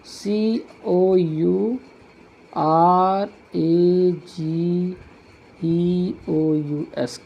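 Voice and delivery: सीओयूआरएजीईओयूएस very slow, letter by letter; spelled out slowly. Shown as a demonstration. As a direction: draw out each letter with a gap between. Teacher spelling a word aloud. (अक्षर-अक्षर धीरे-धीरे spelling बोलते हुए)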